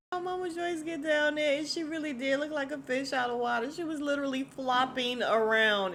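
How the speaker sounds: high, loud amused telling; excited laughing. Real, not acted. Laughing at Mama Joyce floundering.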